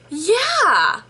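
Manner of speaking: high, emphatic drawn-out yeah; hammed-up high-pitched yes; mocked customer reply. Customer agrees yet again, mocked.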